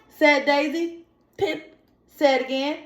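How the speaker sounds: lively read-aloud, pitch lifting on the story lines; storybook reading. Acted. Teacher reading a picture book aloud to young students. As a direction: read the story to the children with a little life.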